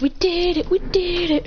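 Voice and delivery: high, chanting celebration; acted victory cheer. Boy celebrating the captured flag, high excited cheer.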